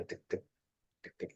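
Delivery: soft, even "tik, tik"; soft heartbeat ticking. Shown as a demonstration. Coach shows teman-teman a stable heartbeat, soft ticking. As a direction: tick quietly like a settled heartbeat.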